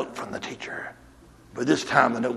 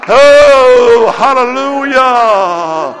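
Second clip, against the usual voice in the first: preaching at full force; 11 semitones higher, 15 dB louder than usual. Acted. Preacher rousing the church against the devil, building to shouts. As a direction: rise until you're shouting it to the rafters.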